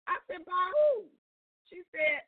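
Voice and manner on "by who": high-pitched, louder incredulous question; mock-shocked, voice jumping up. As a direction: retell it as stunned disbelief. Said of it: "I was in such shock".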